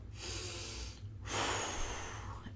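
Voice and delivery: slow audible blow; long blowing exhale. Shown as a demonstration. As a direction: blow out like a candle. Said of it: "a visual of a child blowing out candles".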